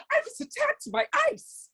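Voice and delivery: very high whiny claim; mock fake victim. Hypothetical parody for Yasmin, very high whiny voice. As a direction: squeal it like a faker.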